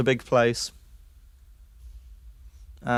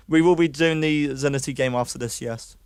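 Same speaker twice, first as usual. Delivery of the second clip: ordinary talk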